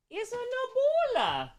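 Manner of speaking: high sing-song pet talk. Real, not acted. Cooing over her cat.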